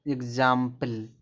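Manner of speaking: Example drawn out slowly, part by part; stretched-out model pronunciation of example; slow demonstration. Shown as a demonstration. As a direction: say example slowly so learners hear the g-z sound. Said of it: "जैसे आपको अगर बोलना है".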